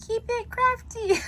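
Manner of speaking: very high, goofy squeal on crafty; squeaky catchphrase. Real, not acted. Crafter's first try at her sign-off catchphrase, squeaky high. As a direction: blurt the catchphrase in a silly high voice. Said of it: "I'm such a dork".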